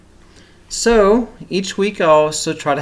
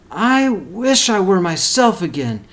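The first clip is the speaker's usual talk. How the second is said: higher, rising urgent wish; Sylvester's wish; 7 semitones higher, 4 dB louder than usual. Acted. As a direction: plead the wish harder the second time. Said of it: "thought Sylvester".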